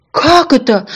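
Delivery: high, loud outburst; indignant cry. A narrator's reading. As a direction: cry out in protest. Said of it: "закричал маленький ослик".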